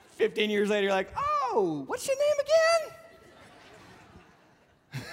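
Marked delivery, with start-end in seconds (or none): 1.1-5.0 high, puzzled airy voice; clueless friend. Retold or imitated. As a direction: ask it sweetly like you truly forgot. Old friend forgotten after years.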